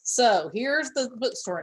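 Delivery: normal talking voice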